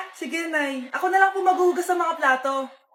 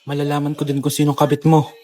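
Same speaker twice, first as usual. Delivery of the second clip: very low pitched-down threat; deep menacing voice. Acted. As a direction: growl the vow in a deep voice. Pitched-down sinister vow.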